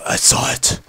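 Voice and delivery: voiceless, husky superhero rasp; hushed husky impersonation; Batman. Acted. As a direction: rasp it like a brooding masked hero. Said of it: "Why do I sound like Batman?".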